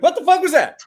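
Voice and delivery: high, loud startled yelp of a dog; squeaky panicked dog voice. Retold or imitated. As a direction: play the dumb dog jumping at its own fart.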